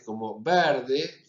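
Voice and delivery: verde slowed, its E stretched wide open; exaggerated open E. Shown as a demonstration. Teacher exaggerates the open E in verde for students. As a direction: drag out verde with an overdone open E. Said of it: "lo hago un poco exagerado".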